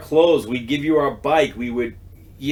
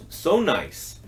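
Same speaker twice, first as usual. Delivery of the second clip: ordinary talk, nothing marked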